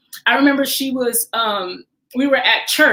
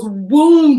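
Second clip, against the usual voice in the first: loud mock boom; 3 semitones higher, 7 dB louder than usual. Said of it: "They would say".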